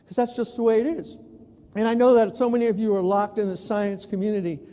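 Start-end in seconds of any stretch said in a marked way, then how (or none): none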